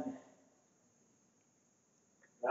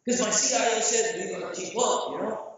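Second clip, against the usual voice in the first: ordinary talk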